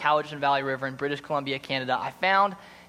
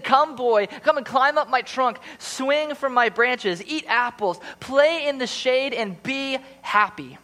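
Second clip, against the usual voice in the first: higher, eager call; joyful invitation; 9 semitones higher, 5 dB louder, slower than usual. Acted. Tree welcoming the returning boy, joyful call. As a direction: beckon him back with delight.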